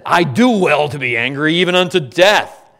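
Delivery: loud, defiant preacher's cry; booming indignant reply; Jonah's angry retort. Acted. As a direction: hurl it out as the sulking prophet.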